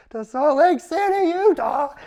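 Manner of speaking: high, slow, voice cracking in disbelief; stunned squeak. Retold or imitated. Reading his mission call aloud, high stunned squeak. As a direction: squeak it out in shock. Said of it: "And my voice".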